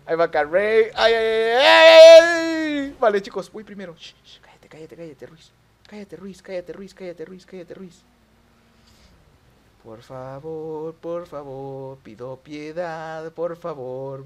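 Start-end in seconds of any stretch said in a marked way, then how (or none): 0.9-3.0 cries climbing into a high, loud yell; alarmed yell. Real, not acted. Player seeing Carrey come at him, rising alarmed yell. 4.0-8.0 very soft, rapid repeated hushing; hushed shushing. Real, not acted. Player hushing Ruiz as he goes first, very soft repeated muttering. 9.8-14.2 slow, soft, drawn-out begging; soft pleading. Real, not acted. Player begging for mercy in the game, slow soft plea.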